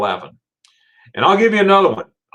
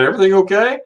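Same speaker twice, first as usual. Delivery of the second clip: high, loud rising question; acted bewildered concern; puzzled checking; 8 semitones higher, 5 dB louder, faster than usual. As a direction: ask the laughing room in confusion.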